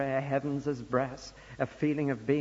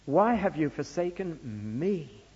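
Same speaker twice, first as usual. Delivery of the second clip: "me" held long at the end of the question; stress on ME. Shown as a demonstration. Preacher showing what was troubling Jesus, stress on the last word "me". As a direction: save the weight for the final word "me". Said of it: "I've put the emphasis in my paraphrase on the last".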